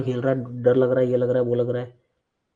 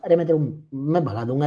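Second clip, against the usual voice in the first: ordinary delivery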